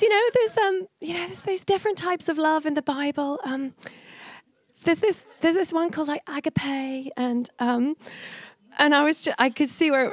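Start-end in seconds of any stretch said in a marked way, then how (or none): 0.0-7.1 a mock nervous boyfriend, pitched higher; lightly hammed-up fumbling young man; higher, hesitant mock of her boyfriend; imitating Ben. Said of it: "he goes".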